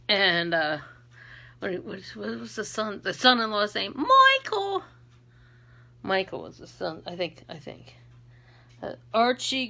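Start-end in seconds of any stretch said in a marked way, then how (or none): 3.9-4.8 put-on high squawked sitcom accent; Edith Bunker. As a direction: call the name shrilly in a hammed-up accent. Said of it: "I was always doing that accent".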